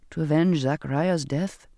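ordinary delivery, nothing marked

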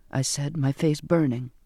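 usual speaking voice

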